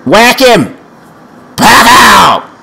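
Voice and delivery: high, loud shout on impact; excited battle cry. Real, not acted. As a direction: yell it as the blow lands. Cheering own hit in game.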